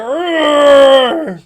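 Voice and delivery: very loud, high roar; dragon roar. Acted. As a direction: let the dragon bellow.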